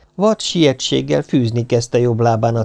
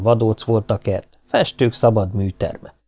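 plain reading voice

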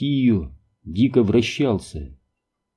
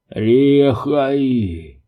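faint, muffled voice from under a helmet; echoing voice inside helmet; 3 semitones higher than usual. Acted. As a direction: speak weakly as if sealed in a helmet. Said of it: "донёсся слабый голос из-под шлема".

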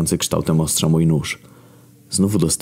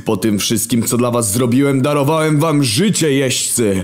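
lofty raised voice of the Creator; wounded divine authority; God; 8 semitones higher, 3 dB louder than usual. Acted. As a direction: speak down from heaven, aggrieved. God addresses the horsemen.

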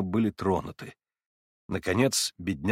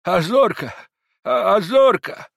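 put-on high, loud, slow old man calling; trembling old man; 9 semitones higher, 8 dB louder, slower than usual. A narrator's reading. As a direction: call the dog in a quavering old voice. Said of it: "прошамкал он дрожащим старческим голосом".

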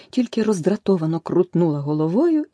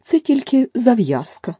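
louder, higher, slow, stress on Це; angry; 6 semitones higher, 5 dB louder than usual. A narrator's reading. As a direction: hammer down Це angrily. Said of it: "ще дужче розсердилася миша". (сердито, з наголосом на «Це»)

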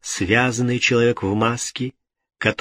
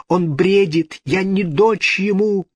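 high-pitched frantic denial; desperate cry; 9 semitones higher, 4 dB louder than usual. A narrator's reading. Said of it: "вскричала итальянка".

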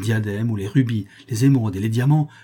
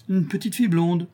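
higher voice, echoing as an answer; old crone confirming; 8 semitones higher than usual. Acted. Old woman confirming to the countess, crone's echo.

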